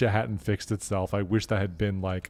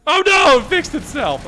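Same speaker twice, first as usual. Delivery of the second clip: high, loud cry of disappointment; real anguished outburst, falling off; loud dismay; 14 semitones higher, 10 dB louder than usual. Real, not acted. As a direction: wail it as the fun ends. Dismay as the funny glitch ends.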